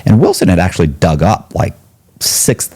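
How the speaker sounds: ordinary talk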